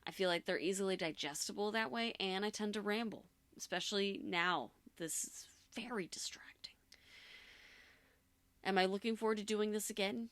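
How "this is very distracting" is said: whispering. Real, not acted. Aside while recording.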